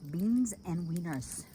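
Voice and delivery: drawn out, savoring each word; slow announcement. Real, not acted. Solo camper showing viewers supper, slow and drawn out. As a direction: announce it slowly like a treat.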